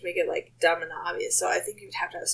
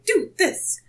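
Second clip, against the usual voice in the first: bossy command; 9 semitones higher, 4 dB louder than usual. Retold or imitated. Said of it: "smack patients and say".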